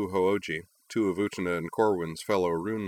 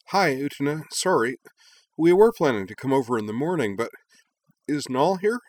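higher, breathless and urgent; out of breath; 7 semitones higher, 5 dB louder than usual. A narrator's reading. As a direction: gasp it out after running. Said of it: "flushed and out of breath".

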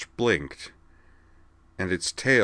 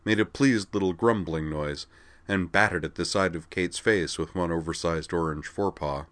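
ordinary delivery

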